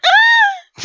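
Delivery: very high, loud frightened shriek; scream "Ah". Retold or imitated. Girls fleeing from him, high scream. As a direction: shriek and flee. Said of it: "fled out the window and just went".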